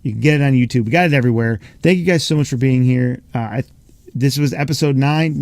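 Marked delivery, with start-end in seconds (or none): none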